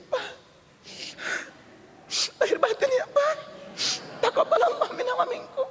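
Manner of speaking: high, tearful, quavering plea; mock tearful wife. Wife asking her husband's forgiveness at Lebaran, mock tearful and high.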